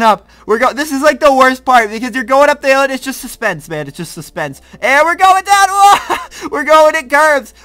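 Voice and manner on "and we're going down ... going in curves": high, loud thrill-ride yelling as the drop hits; excited yell. Real, not acted. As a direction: let it rip as the coaster plunges. Coaster drops, rider yells.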